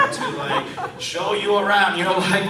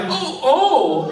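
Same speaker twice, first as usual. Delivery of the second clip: 1 cowardly; 10 semitones higher, 4 dB louder than usual. Retold or imitated. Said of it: "because I'm a coward, I was like".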